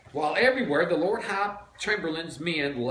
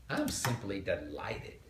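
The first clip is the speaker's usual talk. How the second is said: mock delighted; 7 semitones lower, 7 dB quieter than usual. A narrator's reading. Mimics delighted Melissa.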